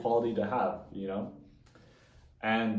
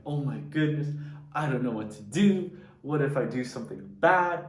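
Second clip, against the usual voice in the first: retold nervous panic, higher and louder; mock panic; 5 semitones higher, 5 dB louder than usual. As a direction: replay the babysitting jitters, half-hearted. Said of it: "I was so scared, I was so nervous".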